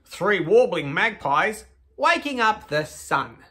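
slow, lilting storybook read; reading to children. Acted. Hosts reading to kids at home, storybook voice.

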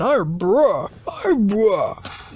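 low "iron bruh" with open "brah"; mispronounced Irn-Bru. Acted. Learner reading the name wrongly, low "iron bruh". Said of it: "It's pronounced iron brew".

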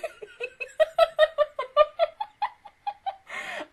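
long, high-pitched giggling; high laughing fit. Real, not acted. Laughing at her own threat to coworkers, high giggles.

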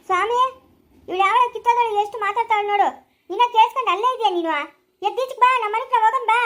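high, loud furious call; angry shouting. Acted. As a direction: shout him out of her house. Quarrel, shouting for husband. (ಜಗಳದಲ್ಲಿ ಸಿಟ್ಟಿನಿಂದ ಕೂಗುವುದು)

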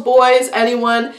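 ordinary talk, nothing marked

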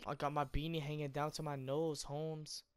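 lightly put-on Mexican gangster voice; half-hearted mock Mexican slang; mock Mexican accent.

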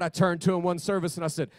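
ordinary talk, nothing marked

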